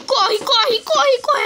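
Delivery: urgent rising shout of run; panicked yelling to run; being chased. Real, not acted. Urging flight from a mob.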